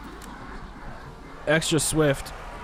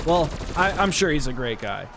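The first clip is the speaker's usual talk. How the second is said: ordinary talk, nothing marked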